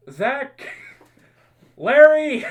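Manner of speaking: yelled names, rising high and loud; calling out names. Retold or imitated. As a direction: call them in from far off. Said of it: "they'd start yelling out names".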